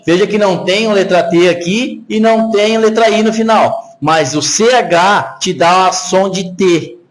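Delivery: ordinary talk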